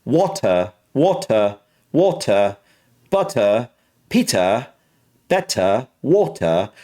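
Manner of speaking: learner's British drill, crisp T; British accent. Shown as a demonstration. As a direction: practise British endings without the R. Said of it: "I'm just trying to learn this".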